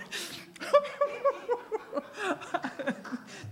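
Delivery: a burst of high surprised laughter. Real, not acted. Surprised by the huge number.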